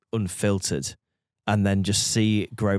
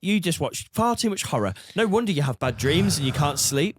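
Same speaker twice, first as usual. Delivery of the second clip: a bit higher put-on voice, teasing; mock killer voice; 7 semitones higher than usual. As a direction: needle your friend in his odd voice. Mocking friend in a voice.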